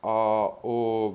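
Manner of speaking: long open A, then rounded O; vowel contrast demo. Shown as a demonstration. Teacher letting learners hear the difference, A then O held apart. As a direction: draw out A and O as two distinct vowels. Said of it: "vous avez bien entendu la différence".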